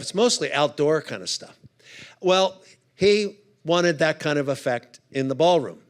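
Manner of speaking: usual speaking voice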